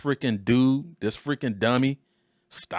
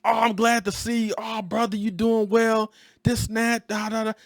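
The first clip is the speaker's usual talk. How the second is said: high, phony-sweet greeting; a hint of a phony old boss; fake friendly; 11 semitones higher than usual. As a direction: lay on the fake warmth. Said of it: "three dollar bill fake".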